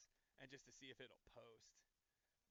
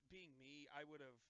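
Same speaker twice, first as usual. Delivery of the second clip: ordinary delivery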